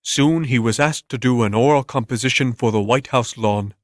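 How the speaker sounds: ordinary delivery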